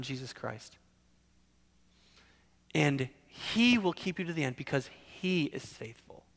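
ordinary talk

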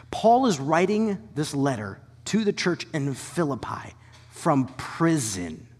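normal talking voice

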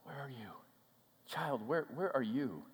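soft, halting, gentle question; softly seek out a lost child; intimate, near-whispered call; hushed tender calling. Acted.

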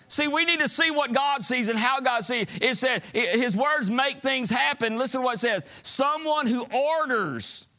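high, loud, driving sermon delivery; full-force preaching. Real, not acted. Preacher challenging church about their words, high full-force preaching. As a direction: raise it to an insistent preacher's shout.

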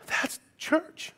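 a hushed whisper to land the point. Real, not acted. Pastor whispers the moral to the congregation, hushed. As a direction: breathe it out, no voice. Whispered punchline after story.

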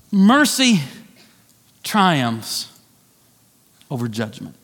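Mercy, triumphs, over judgment, each word set apart by pauses. Shown as a demonstration. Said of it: "repeated with pauses".

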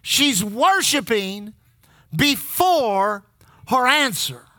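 slow, with pauses between the words; she's worshiping, pause, before, pause, her answer; slow spaced phrasing. Shown as a demonstration. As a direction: lay out each piece slowly. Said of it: "We'll say it one more time".

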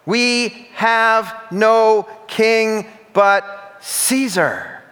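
loud answer. A narrator's reading. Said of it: "the chief priests answered".

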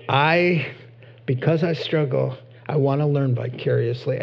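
ordinary delivery

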